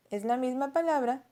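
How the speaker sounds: normal talking voice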